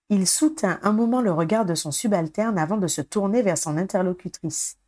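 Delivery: ordinary delivery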